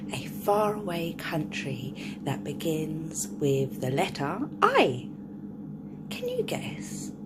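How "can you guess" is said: plain reading voice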